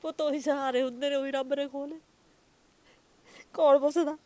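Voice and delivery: real grief, crying out that nobody asks after them. Real, not acted. Mother weeps to the reporter that nobody cares, crying. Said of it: "ਰੋਵੋ ਨਾ ਤੁਸੀਂ".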